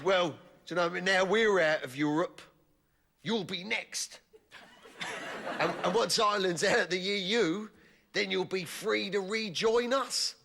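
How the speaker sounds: mock-friendly pensioner in the sauna; friendly old man. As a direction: beam at him like an old pal. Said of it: "in the friendliest way possible".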